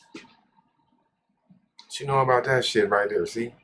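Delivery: swagger brag. Pretend.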